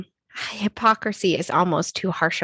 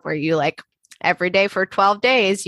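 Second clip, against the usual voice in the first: ordinary talk, nothing marked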